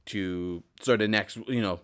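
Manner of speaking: normal talking voice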